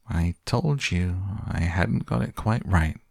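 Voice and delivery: ordinary delivery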